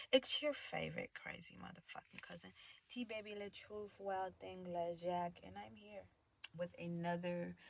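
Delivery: hushed, low-key self-introduction; quiet show-opener voice; soft intro. Real, not acted. Delivers the newly chosen intro.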